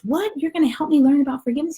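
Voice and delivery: high, rapid disbelief; astonished little soul. Acted. Little soul questions the helper, astonished and high. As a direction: ask it fast in an amazed childlike pitch. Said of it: "he says".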